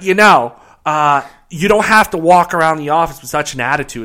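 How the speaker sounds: put-on high, loud Boston accent, scolding. Guest quoting the coworker's scolding in a Boston accent. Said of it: "that Boston accent".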